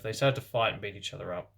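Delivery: usual speaking voice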